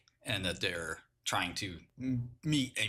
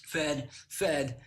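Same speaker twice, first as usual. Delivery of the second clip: normal talking voice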